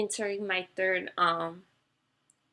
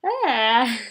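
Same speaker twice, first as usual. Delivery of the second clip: emotional gasp; 3 semitones higher, 11 dB louder than usual. Real, not acted. Breaking down on camera.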